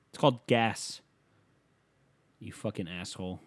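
normal talking voice

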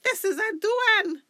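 very high, loud exclamation; horrified cry. A narrator's reading. Woman recognising the spirit child, horrified cry. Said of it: "she cried".

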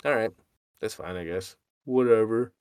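quiet resigned shrug. Retold or imitated. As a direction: let it go resignedly. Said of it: "And I was like".

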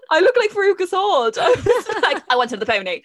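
high, loud words through real laughter; laughing. Real, not acted. Teller mocking their bratty child self, laughing. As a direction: laugh helplessly while telling it.

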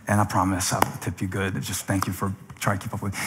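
embarrassed apology trailing off to almost nothing; sheepish apology. Retold or imitated. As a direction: apologize meekly to the young server. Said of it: "I'm like".

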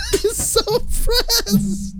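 high, slow words through laughter; real amused laughter, drawn out; laughing hard. Real, not acted. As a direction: laugh helplessly while talking. Laughing at the other's outburst.

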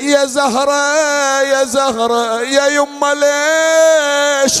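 slow, drawn-out keening with a sob; mournful wail, voice near breaking; wailing lament. Acted. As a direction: cry out to the lost mother. Wailing repeated pleas.

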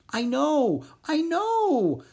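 high, rising frog voice; calm the upset bird. Acted. Pip soothing the shrieking hippogriff, high.

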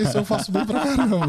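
chuckling through the boast; laughing. Real, not acted. Boasting to friends that he drinks well, laughing. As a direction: let a laugh break into the words. Joking about drinking the wine.

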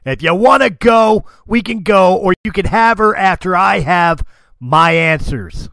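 loud, forceful in-character demand; defiant challenge. Acted. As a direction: shout an ultimatum at the dragon. Farron challenges the dragon.

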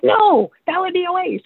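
hammed-up high indignant voice; mock objection. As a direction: squeal the objection like a busybody. Mimics the arguers objecting.

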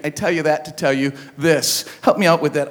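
usual speaking voice

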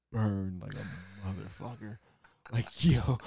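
ordinary delivery

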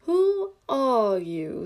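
very slow, drawn-out sleepy caterpillar; languid, sleepy. A narrator's reading. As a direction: stretch each word out languidly. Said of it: "addressed her in a languid, sleepy voice".

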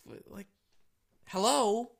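high, exasperated disbelief; high incredulous hello; incredulous retort. Retold or imitated. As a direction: throw it back at them, high and baffled. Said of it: "I'm like".

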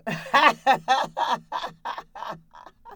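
loud, high hearty laugh from deep in the belly. Shown as a demonstration. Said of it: "Deep breath and laughter".

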